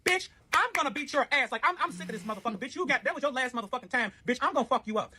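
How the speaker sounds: high, heated threatening rant; furious threat. Retold or imitated. Narrator retelling threatening the ex, furious rant. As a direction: go off on her, ready to fight.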